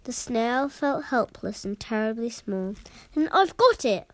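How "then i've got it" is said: higher, louder burst of an idea; excited cry. A narrator's reading. Snail hitting on a plan to save the whale, excited cry. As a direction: cry out with sudden inspiration. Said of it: "she cried".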